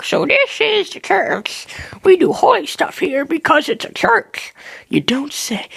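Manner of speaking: high-pitched put-on tour guide. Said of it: "this little tour dude. He was like".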